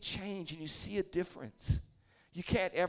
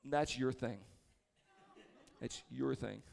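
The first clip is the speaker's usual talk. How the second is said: put-on lower, softer teen brush-off; dismissive son; 5 semitones lower, 7 dB quieter, slower than usual. Son brushing off dad's request to pray, low and soft. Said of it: "he's like".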